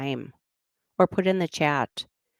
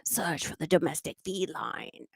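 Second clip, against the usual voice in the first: soft Australian presenter voice; lightly voiced wildlife host; quiet mock nature-show line; 8 dB quieter than usual. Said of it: "Mama Meow videos".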